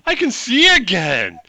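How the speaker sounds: high, drawn-out wonder building to a loud cry; overjoyed orc. Acted. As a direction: marvel slowly, then cry out in sheer joy. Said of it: "he's, like, so happy".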